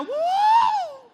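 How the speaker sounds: very high loud whoop; flying cheer. Acted. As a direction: whoop like you are soaring up. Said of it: "Ganun talaga yung sound effects". (masayang sigaw ng paglipad)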